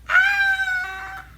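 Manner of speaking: high shrill mock scream. The bloodied hag screams when turned over, high shriek. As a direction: let out a sudden piercing shriek. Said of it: "she just, like".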